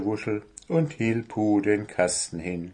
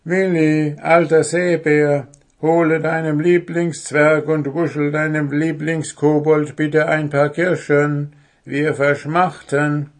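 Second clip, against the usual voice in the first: louder coaxing call, a touch higher; pleading dwarf; 6 semitones higher, 6 dB louder than usual. Acted. Dwarf coaxes owl to fetch cherries, mock pleading. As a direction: wheedle the old owl for cherries. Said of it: "coaxing the owl".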